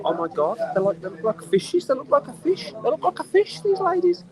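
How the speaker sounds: squeaky, aghast mock reaction; mock horrified disbelief. Acted horrified reaction to faces.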